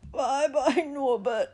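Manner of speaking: high, loud tearful farewell; sobbing. A narrator's reading. Gamekeeper bids his baby dragon farewell, sobbing. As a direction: sob out a hammed-up goodbye. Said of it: "Hagrid sobbed".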